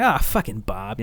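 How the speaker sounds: low, soft weary grumble; resigned dismay. Retold or imitated. Host reacting to clips of a namesake, low resigned dismay. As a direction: let it out like a disappointed groan.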